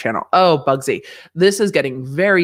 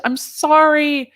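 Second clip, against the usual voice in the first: high, drawn-out sorry; mock-sheepish high squeal of sorry; squeaky apology; 13 semitones higher, 3 dB louder, much slower than usual. Real, not acted. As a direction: whine the apology up high and stretched. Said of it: "which I didn't".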